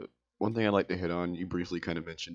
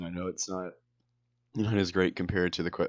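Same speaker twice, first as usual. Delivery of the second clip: normal talking voice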